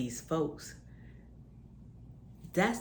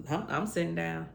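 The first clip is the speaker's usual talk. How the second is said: normal talking voice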